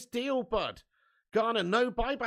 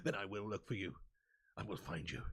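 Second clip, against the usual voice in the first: lower, menacing Northern Irish Liam Neeson voice; 10 semitones lower, 11 dB quieter than usual. Retold or imitated. Ten Hag on the phone threatening Barcelona, lower Northern Irish Liam Neeson voice. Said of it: "it's a terrible Northern Irish accent".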